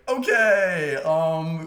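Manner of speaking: high, loud awkward okay; stunned teammates; awkward surprise. Retold or imitated.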